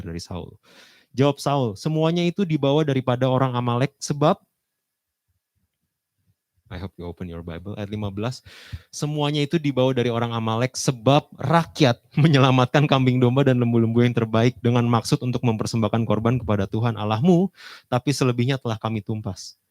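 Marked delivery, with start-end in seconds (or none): 1.1-4.4 loud, high reading of Saul's excuse; preaching at full force. Acted. Preacher reading Saul's answer to the congregation, loud. 8.9-19.6 loud, high reading of Saul's excuse; preaching at full force. Acted. Preacher reading Saul's answer to the congregation, loud.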